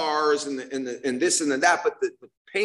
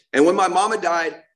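normal talking voice

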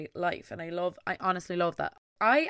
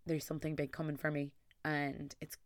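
ordinary delivery

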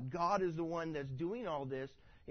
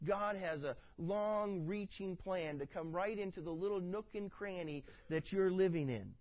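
usual speaking voice

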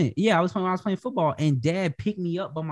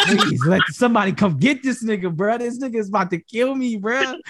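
mock pleading; 9 semitones higher than usual. Retold pleading as a scared child.

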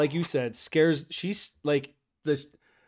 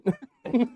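high giggle; 8 semitones higher, 3 dB louder than usual. Real, not acted. Laughs at own joke.

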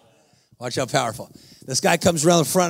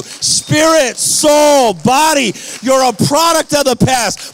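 high, driving pulpit delivery; full-force preaching; 6 semitones higher, 4 dB louder, slower than usual. Real, not acted. Preacher answering the healed man, fervent high preaching. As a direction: punch out each phrase with conviction.

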